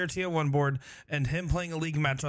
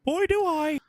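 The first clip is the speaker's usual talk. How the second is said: eager fan voice; 13 semitones higher, 5 dB louder than usual. Pretend. Scripted eager fan skit.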